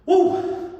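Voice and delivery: breathy excited woo; high unvoiced whoop. Real, not acted. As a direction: let out a high whoop of effort. Coach whoops between rounds.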